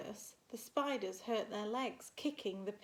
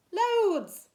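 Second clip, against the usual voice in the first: high, loud cheeky sibling shout; 11 semitones higher, 14 dB louder than usual. Acted. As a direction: shout it like a gang of kids. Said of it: "said her brothers and sisters".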